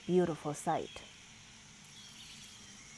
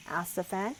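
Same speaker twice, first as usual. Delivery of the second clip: ordinary delivery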